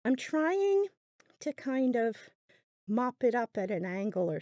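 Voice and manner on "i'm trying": ordinary talk, nothing marked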